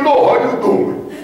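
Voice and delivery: high, drawn-out mock Italian-American greeting; New York Italian accent. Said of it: "This guy doesn't talk like this".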